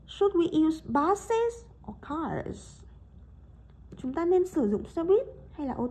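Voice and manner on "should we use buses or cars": "should we use buses or cars?" slow, rising up to "buses", falling on "or cars"; choice intonation, slow. Shown as a demonstration. Teacher models a second choice question very slowly. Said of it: "Hoặc là".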